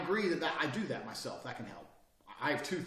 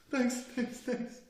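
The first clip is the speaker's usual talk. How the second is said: high, gushing mock-happy thanks; mock grinning customer; 10 semitones higher, much slower than usual. Mocking a man thrilled over Skittles, higher and gushing. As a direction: ham up an overeager smiley guy. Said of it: "The fuck you happy about?".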